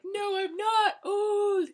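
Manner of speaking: high, loud, slow wailing cry; mock crying shout. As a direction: wail it like a melodramatic Bella. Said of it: "she's like".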